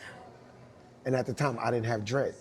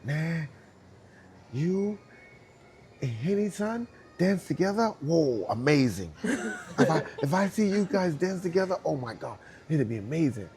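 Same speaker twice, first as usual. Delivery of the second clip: imitating a Japanese dancer; 6 semitones higher, 3 dB louder than usual. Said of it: "He's like".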